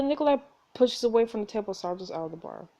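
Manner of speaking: usual speaking voice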